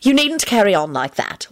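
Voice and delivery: mildly stern scolding; firm reproof with little rise. A narrator's reading. Mother scolding melodramatic boy, mildly stern. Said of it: "Elizabeth told him sternly".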